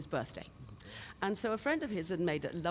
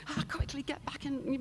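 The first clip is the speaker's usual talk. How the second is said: ordinary delivery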